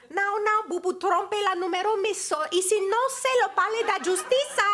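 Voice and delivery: a Portuguese nanny answering the phone in shaky French; high-pitched French with rolled Portuguese vowels; Portuguese-accented French. Retold or imitated. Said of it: "the accent would be quite different".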